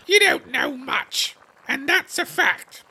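high, slow scornful put-down; rude tone. Acted. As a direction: sneer it down at her. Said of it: "Alice did not like the tone".

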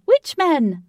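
high, loud, repeated with rising glee. A narrator's reading. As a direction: push the excitement further. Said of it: "who was popping with excitement".

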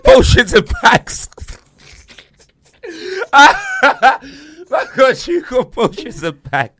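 slow, high, loud words through helpless laughter; crying with laughter over the title; laughing. Real, not acted. Mocking the copycat song title, slow and laughing.

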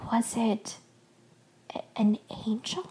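hushed, stammered question; whisper. A narrator's reading. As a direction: whisper it haltingly, hardly daring to ask. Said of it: "my mother whispered".

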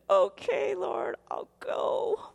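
slow breathy whisper of giving in; hushed, resigned prayer of surrender. Retold or imitated. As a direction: barely voice it, finally yielding. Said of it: "I said".

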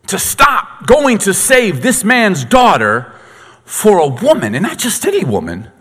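high, loud, slowed preacher's build; fiery sermon climb; preaching at full force. Acted. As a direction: drive it home from the pulpit.

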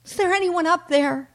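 high-pitched call for help; sheep calling out. Acted. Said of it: "the sheep yelled upward".

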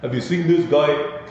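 a hammed-up Brooklyn "guy", rising at the end; Brooklyn accent. Lecturer retries the Brooklyn accent, then calls it terrible. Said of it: "That's my Brooklyn accent".

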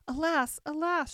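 put-on distraught girl's cry of alas; sobbing lament. A narrator's reading. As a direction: wail in grief. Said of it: "sobbing bitterly".

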